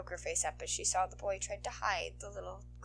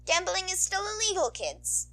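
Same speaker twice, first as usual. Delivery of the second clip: higher, louder scolding bark; barking old nurse; 12 semitones higher, 8 dB louder than usual. Acted. Old nurse scolding students over betting, barking. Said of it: "Chio barked".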